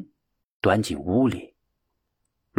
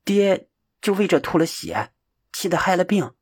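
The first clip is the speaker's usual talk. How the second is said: put-on high, troubled child voice; a frowning boy asking, higher; worried child; 7 semitones higher than usual. A narrator's reading. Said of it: "皱着眉头问".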